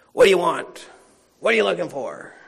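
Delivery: terse, forceful questions. Shown as a demonstration. Preacher trying another inflection on Jesus' words, terse and demanding. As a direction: fire off the question loudly and curtly. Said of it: "He seems a little terse".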